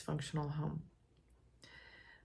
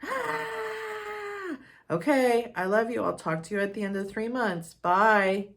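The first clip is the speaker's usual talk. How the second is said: mother backing down; 6 semitones higher, 7 dB louder than usual. Retold or imitated. Mother backs down on phone.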